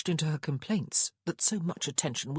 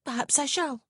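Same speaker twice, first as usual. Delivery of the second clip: sniffy; 9 semitones higher than usual. A narrator's reading. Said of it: "Prudence sniffed".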